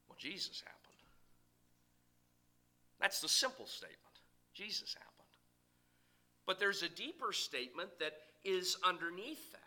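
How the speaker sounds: hushed. Acted. Dramatic hush at sermon climax.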